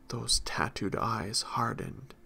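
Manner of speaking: ordinary delivery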